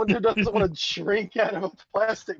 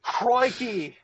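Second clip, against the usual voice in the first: mock Aussie; 3 semitones higher than usual. Mocking Australian stereotypes.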